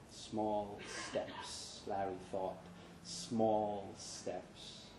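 whispered-soft private mantra; soft inner thought. Acted. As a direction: tell yourself quietly.

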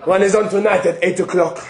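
ordinary talk, nothing marked

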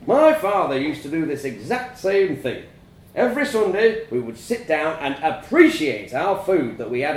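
a father preaching tradition to his family; stern farmer father lecturing. A narrator's reading.

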